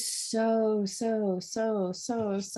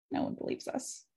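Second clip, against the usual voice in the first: soft whispered echo; 10 dB quieter than usual. Shown as a demonstration. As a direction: drop to an unvoiced whisper. Repeats the line, then whispers it.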